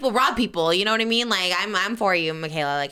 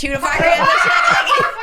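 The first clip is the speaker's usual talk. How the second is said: loud, high-pitched parody sponsor voice; mock ad read; 7 dB louder than usual. Co-host mocks an ad delivery, very high loud ad voice. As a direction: blow the ad copy up absurdly. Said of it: "you wouldn't do that for an ad read".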